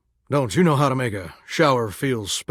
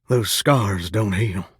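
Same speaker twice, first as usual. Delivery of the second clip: usual speaking voice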